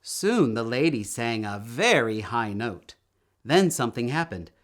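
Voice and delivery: plain reading voice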